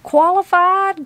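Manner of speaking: high, incredulous, indignant echo; launched into indignation. A narrator's reading. Granny launches into the judges, high indignation. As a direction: throw the word back, pitched right up. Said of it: "Granny was launched".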